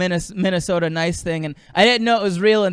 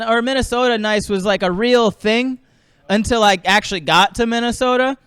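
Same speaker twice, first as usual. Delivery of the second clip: usual speaking voice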